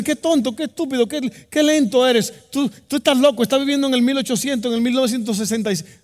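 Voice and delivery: high, loud jeering; mocking schoolmates. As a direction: pile on the insults like jeering classmates. Schoolmates mock the one who refuses.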